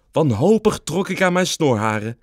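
usual speaking voice

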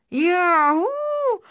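huge high battle cry; loud, soaring whoop; roaring. A narrator's reading. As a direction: roar it out, rising very high. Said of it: "brulde Elvis".

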